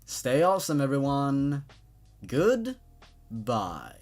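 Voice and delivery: ordinary talk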